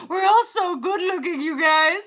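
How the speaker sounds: high, loud, laughing through the words. Real, not acted. Laughing at her own joke to viewers, giggling. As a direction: giggle out the compliment.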